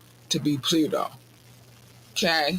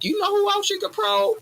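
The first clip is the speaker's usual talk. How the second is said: much higher, sly mock-helpful voice; snitching friend. A friend offering aliens a referral, in a high mock-helpful voice. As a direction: squeak it like a snitch offering others up. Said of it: "don't be giving them no referrals".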